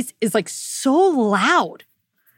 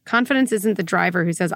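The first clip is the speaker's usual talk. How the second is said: ordinary talk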